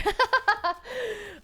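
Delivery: high burst of laughter; laughing. Real, not acted. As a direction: laugh it off, embarrassed. Laughs after tearing up.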